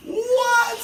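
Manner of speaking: high loud shocked what. Retold or imitated. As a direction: blurt it out stunned. Shocked reaction, then big laugh.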